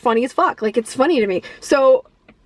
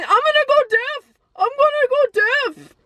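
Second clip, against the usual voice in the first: much higher, whiny mock panic; panicked whine; 11 semitones higher than usual. Vlogger mimicking Keanu during the headphone torture, high panicked whine. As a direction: wail it high like a helpless victim. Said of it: "And he keeps going".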